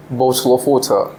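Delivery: British accent with clearly sounded T in "water"; Queen's English accent. Shown as a demonstration. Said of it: "United Kingdom's accent".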